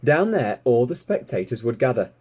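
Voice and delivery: ordinary delivery, nothing marked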